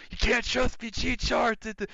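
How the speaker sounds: high, squeaky mock doubter; a skeptical commenter mocked in a high voice. Some were like.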